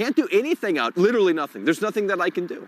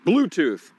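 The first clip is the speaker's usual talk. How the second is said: ordinary talk, nothing marked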